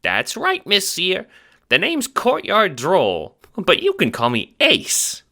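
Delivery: high squeaky Italian-American chipmunk; fast-talking little mobster, squeaky; Ace, chipmunk wiseguy. Acted. Little guard talks to the Seer, squeaky wiseguy.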